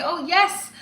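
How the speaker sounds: birthday pep talk to herself, sassy and high; sassy self-affirmation. Retold or imitated. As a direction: pump yourself up like a fabulous diva, calling yourself honey. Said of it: "I have to say".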